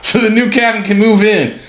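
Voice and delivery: high, hammed-up barking order; mock order. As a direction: boss the old captain out with glee. Guys ordering old captain out.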